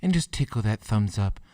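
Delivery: lilting Irish leprechaun voice; playful Irish lilt, teasing. Pretend. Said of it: "I'm starting to sound like a leprechaun".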